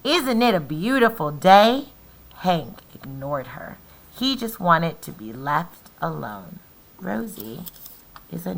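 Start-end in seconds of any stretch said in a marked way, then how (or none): 0.0-1.9 calling out. Acted. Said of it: "Tumbleweed called out". 2.4-5.3 lively, loud storybook reading; animated picture-book read-aloud; reading to children. A narrator's reading. Teacher reads story to class.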